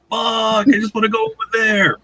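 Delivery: high-pitched mock frustration; high, exasperated put-on protest; comic exasperation. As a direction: wail it as a joking complaint. Frustrated at being held up.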